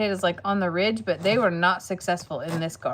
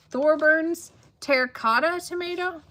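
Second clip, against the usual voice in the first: ordinary talk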